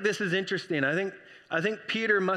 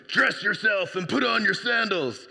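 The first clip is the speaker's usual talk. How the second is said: angel voice; 3 semitones higher, 3 dB louder than usual. Retold or imitated. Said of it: "That's how it is in my head".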